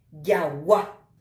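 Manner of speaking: Yawa with the last A loud and stressed; stress on final A. Shown as a demonstration. Teacher shows accent that is only pronounced, final A of Yawa stressed. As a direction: put the force on the final A of Yawa. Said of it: "la intensidad sobre la A".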